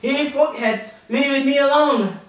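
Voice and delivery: high, loud "Hey, fuckheads, leave me alone"; high loud triumphant "leave me alone"; proud shout. Retold or imitated. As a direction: shout it out proudly, finally getting it right. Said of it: "he said".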